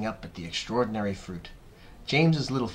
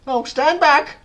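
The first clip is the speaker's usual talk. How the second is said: high, loud alarmed shout; Aunt Sponge shouting; 12 dB louder, slower than usual. A narrator's reading. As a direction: shout a warning to get clear. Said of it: "Aunt Sponge shouted".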